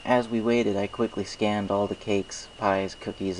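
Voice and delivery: usual speaking voice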